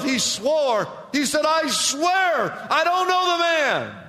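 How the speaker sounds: high, loud, driving sermon voice; full-force pulpit retelling; fiery preaching. Acted. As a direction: preach it hard, climbing with every line.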